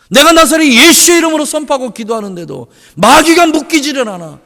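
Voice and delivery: ordinary talk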